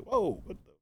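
high-pitched startled gasp of words; startled whoa. Retold or imitated. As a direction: jump up in pitch, caught off guard. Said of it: "like, was like".

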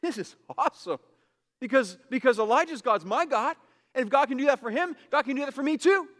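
voicing faith's delight, climbing high and loud; excited voice of faith. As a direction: burst out with joy at the promise. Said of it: "faith says".

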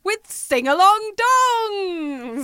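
slow, drawn-out host announcement; game show host. Acted. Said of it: "I feel like I'm on a game show".

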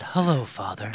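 low, soft robotic monotone. Pretend. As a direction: mechanical robot greeting. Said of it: "say it like a robot".

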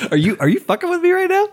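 high, squeaky disbelief with a laugh; can't believe what you just heard; stunned disbelief. Real, not acted. Stunned by friend's pronunciation.

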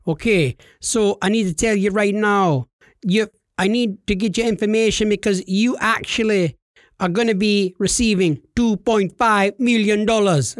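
imitated Jamaican scammer; deliberate Jamaican phone-scammer voice; Jamaican accent. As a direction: deliver the pitch in a put-on Jamaican accent. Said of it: "He was from Jamaica".

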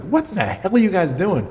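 high-pitched exasperated outcry; mock yelling at the screen, pitch jumping up; retold yelling. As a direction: imitate an outraged viewer without much volume. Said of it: "You're yelling at the computer".

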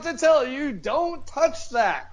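hammed-up nagging partner; a put-on exasperated scold; mock scolding. Mock scolding in comedy bit.

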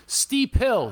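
a guy calling out from behind, pitched high; a lightly voiced stranger's warning; stranger's line. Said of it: "He goes".

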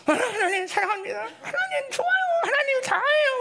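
high, strained declarations of love; forced devotion. Acted. Believer forcing out love for God instead of complaint, strained. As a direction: squeeze out praise through bruised lips. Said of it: "입술에 피멍이 들어도 절대로".

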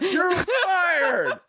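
laughing mock yell. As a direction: bark it out through laughter. Joking outburst while laughing.

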